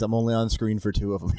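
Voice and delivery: ordinary talk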